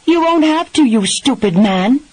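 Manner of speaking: high, loud angry yell; furious parting shout at her husband; shouted parting shot. A narrator's reading. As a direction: fling the insult back as she storms off. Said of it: "the parting shot".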